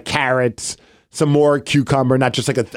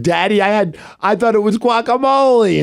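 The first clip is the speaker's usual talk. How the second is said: put-on high, excited small-child voice; imitated little daughter; 7 semitones higher than usual. Daughter telling daddy her funny mistake, imitated child voice. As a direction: mimic a three-year-old telling her funny story. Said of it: "She could not stop laughing".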